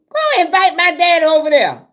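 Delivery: high, loud teasing holler across the yard; loud mock holler. Neighbor calling across the yard, loud mock holler. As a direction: holler it over like a tipsy neighbor. Mocking a drunk neighbor's call.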